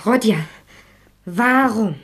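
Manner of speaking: urgent plea. Acted. Sonja pleading.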